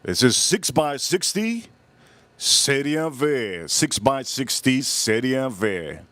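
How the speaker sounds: slow, drawn-out reading of the name; measured, stretched-out product naming; deliberate announcement. Shown as a demonstration. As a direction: say the size and name slowly, stretching each word. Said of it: "here we go, let me say it".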